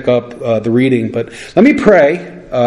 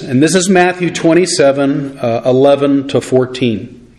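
ordinary talk

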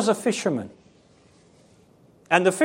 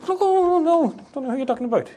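hammed-up innocent denial, high and quick; Peter; 7 semitones higher, faster than usual. Disciple dusting down his acting skills, badly feigned denial. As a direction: feign ignorance in a high string of noes. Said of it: "dusting down all his acting skills".

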